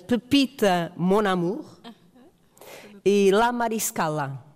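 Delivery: Pepita Mon Amour e La Mariscala ditos devagar, com pronúncia castelhana; títulos em castelhano. Shown as a demonstration. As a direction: tentar pronunciar La Mariscala à espanhola, com cuidado. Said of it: "vou tentar em castelhano". (títulos lidos devagar com pronúncia espanhola)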